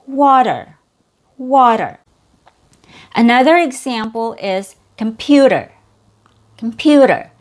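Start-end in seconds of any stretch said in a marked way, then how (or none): 0.1-2.0 "water" with a strong high "wa" and reduced "der"; fast D. Shown as a demonstration. Teacher putting "water" back together for learners, fast D. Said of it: "has a fast D sound". 5.0-7.3 "computer" with a stressed "pew" and flapped d; fast D. Shown as a demonstration. Teacher introducing the example "computer", American fast D. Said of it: "has a fast D sound".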